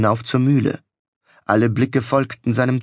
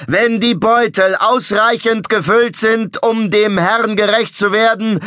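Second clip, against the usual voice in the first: ecstatic showman; 9 semitones higher, 4 dB louder than usual. A narrator's reading. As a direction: proclaim it like a prophet. Showman demanding money.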